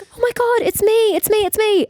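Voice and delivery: high, loud frightened pleading; panicked. Retold or imitated. Startled flatmate caught at the door, high panicked pleading. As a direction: cry out to be recognised in fright. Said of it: "I was like".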